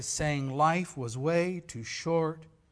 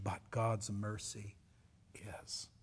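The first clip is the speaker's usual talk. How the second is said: normal talking voice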